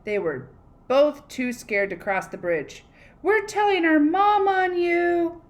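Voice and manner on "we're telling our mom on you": put-on high, loud kid taunt; shouted. Goats shouting at the troll they'll tell mom, high and loud. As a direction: yell it like tattling kids. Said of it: "they shouted".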